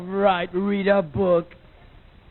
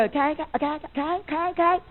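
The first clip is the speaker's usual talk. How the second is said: put-on chirpy kid okay; 9 semitones higher, faster than usual. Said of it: "They go".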